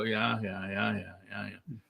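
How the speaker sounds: dull, deflated mumble of yeahs; bored. Shown as a demonstration. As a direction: drone it like nothing matters. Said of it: "go through life and go".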